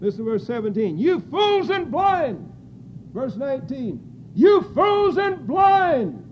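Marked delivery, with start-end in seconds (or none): 1.0-2.5 slow, high, drawn-out preaching wail; preaching cry. Acted. Preacher crying Christ's rebuke to the church, slow preaching wail. 4.3-6.2 slow, high, drawn-out preaching wail; preaching cry. Acted. Preacher crying Christ's rebuke to the church, slow preaching wail.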